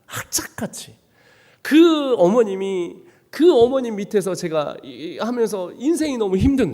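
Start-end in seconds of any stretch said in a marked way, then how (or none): 0.1-1.0 악착같이 breathed out in a hushed whisper; a quiet, intense whisper of 악착같이. Real, not acted. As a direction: whisper 악착같이 to let it sink in.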